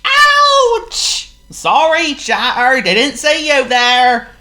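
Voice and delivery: very high loud yelp then slow apology; startled pig on a bike, sorry; high squealy pig apologising; Oink the pig. Acted. Voices Oink.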